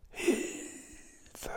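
faint whispered plea for help; a distant, barely voiced plea; ghostly whisper. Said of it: "sondern geflüstert".

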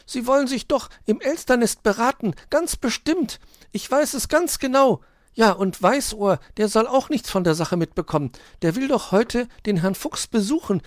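put-on high, loud child-like hedgehog voice; excited little hedgehog. A narrator's reading. Borstel tells Hoppel about the crooks, excited. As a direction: report the crime in a high, urgent voice.

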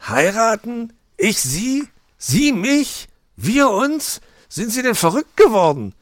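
very high, loud shocked fox voice; horrified outburst. Acted. Mr Fox reacting to Frau Elster's proposal, loud and shocked. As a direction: splutter in disbelief.

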